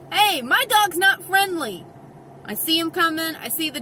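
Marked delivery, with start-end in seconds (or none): 0.1-1.8 raised, higher-pitched warning call. Retold or imitated. Dog owner warning approaching people, called out. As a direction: call out a warning over some distance. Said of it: "how many times I have said to people".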